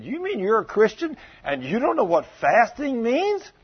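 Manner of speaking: high-pitched disbelieving question; retold incredulity. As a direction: act out being astonished at his ignorance. Pastor incredulous at caller.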